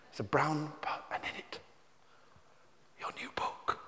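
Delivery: hushed whisper of wonder. Acted. Author whispering the reveal to the children. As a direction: breathe it like a secret.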